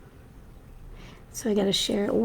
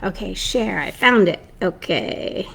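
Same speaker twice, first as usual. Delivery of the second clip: usual speaking voice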